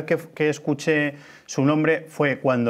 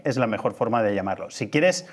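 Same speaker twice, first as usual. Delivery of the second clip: ordinary talk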